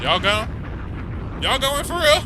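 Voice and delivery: high, loud questioning; loud, high-pitched disbelief; incredulous holler. Real, not acted. Hollering questions back at the video.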